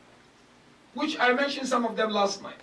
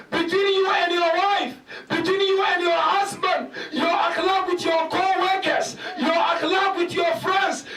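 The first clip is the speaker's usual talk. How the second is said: raised, pressing sermon voice with a punch on akhlaq; fiery preaching; 7 semitones higher than usual. Acted. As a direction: drive the warning home to the congregation.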